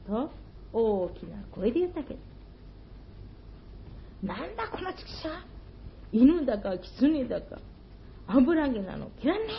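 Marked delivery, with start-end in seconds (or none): 4.2-9.5 high, fast scolding shopkeeper voice; a shopkeeper chasing off a fox; angry shopkeeper. Acted. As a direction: drive the animal off, quick and sharp. Shopkeeper chases fox away.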